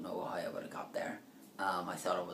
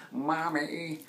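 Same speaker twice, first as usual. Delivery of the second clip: high, loud wail of Mommy!; imitating Mankind's childlike cry; mock wail; Mankind impression; 8 semitones higher, 6 dB louder than usual. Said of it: "that's what obviously Mick Foley used to do as Mankind".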